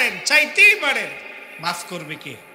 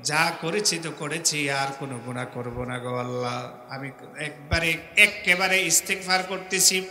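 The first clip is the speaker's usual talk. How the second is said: pleading prayer; 4 semitones lower than usual. Acted. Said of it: "ওয়াদা দিতে হবে". (নরম নিচু গলায় কাতর মোনাজাত)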